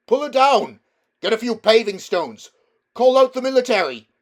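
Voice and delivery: loud, high furious yelling; angry crowd shout. A narrator's reading. Angry crowd shouting at the witch, furious yell. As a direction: roar it like a mob.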